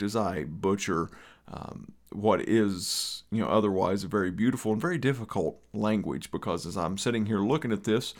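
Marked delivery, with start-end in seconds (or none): none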